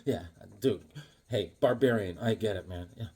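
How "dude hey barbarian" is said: usual speaking voice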